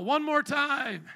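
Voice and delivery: high, drawn-out shout to the crowd; loud preacher's rallying call. Real, not acted. Rallying congregation to repeat louder.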